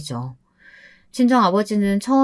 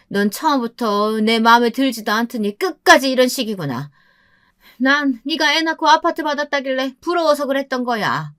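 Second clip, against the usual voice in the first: raised, high-pitched complaint; aggrieved scolding; 5 semitones higher than usual. A narrator's reading. Mother-in-law griping at her daughter-in-law on the phone, aggrieved.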